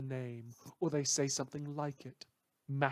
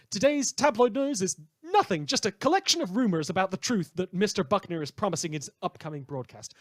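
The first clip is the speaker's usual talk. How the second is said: higher, louder, fast showman patter; brash radio host; 5 semitones higher, 8 dB louder than usual. Acted. Radio host to his listeners, loud brash showman patter. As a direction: rattle off the tabloid news like a hyped DJ.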